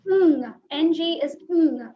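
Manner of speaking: ng held in the back of the mouth, loud; ng, Ng is ng said slowly, no i sound; nasal ng demo. Shown as a demonstration. Teacher correcting ing to ng, slow and firm. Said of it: "ing is".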